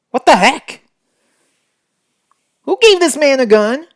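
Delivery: high, loud outraged question; outraged disbelief. Real, not acted. Reader outraged that the cowboy got a gun, loud. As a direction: demand an answer in disbelief.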